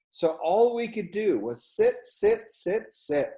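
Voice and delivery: high, slow stretched sits; sing-song sit sit sit; stretched repetition. A narrator's reading. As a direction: draw out each sit. Repeated sit, bored children.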